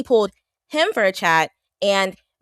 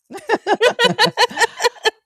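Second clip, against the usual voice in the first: loud laugh; 4 dB louder than usual. Real, not acted.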